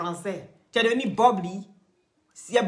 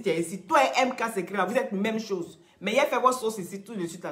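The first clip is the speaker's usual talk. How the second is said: ordinary talk, nothing marked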